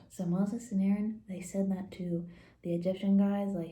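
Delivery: normal talking voice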